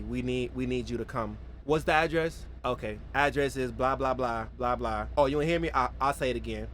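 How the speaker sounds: calmly calling emergency services about a friend's ankle. Retold or imitated. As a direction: talk the dispatcher through it like nothing's wrong. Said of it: "because I was calm".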